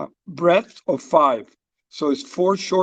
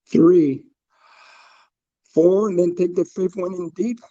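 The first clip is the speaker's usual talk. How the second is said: calm, paced breathing instructions; guided breathwork. Acted. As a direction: guide the group through each breath. Leads a breathing exercise.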